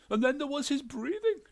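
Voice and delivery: high, loud, fussy complaint; aggrieved ghost wife. A narrator's reading. Ghost wife complains to the detective, high and loud. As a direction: add the grievance, pitched high.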